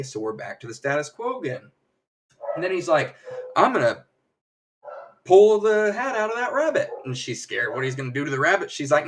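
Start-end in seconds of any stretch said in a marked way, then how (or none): 3.6-6.9 louder, higher mock stage proclamation; showman's announcement. Magician announces his trick to the audience, big showy voice.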